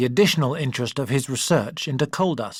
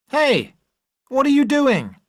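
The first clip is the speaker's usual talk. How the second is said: high, loud call across the stream; shout from afar; 12 semitones higher, 4 dB louder than usual. Acted. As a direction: call out to someone far below. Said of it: "he shouted from the bridge".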